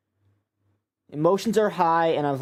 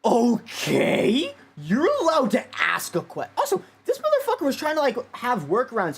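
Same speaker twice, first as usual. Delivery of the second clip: exasperated rant; 9 semitones higher than usual. Real, not acted. Ranting at the clip.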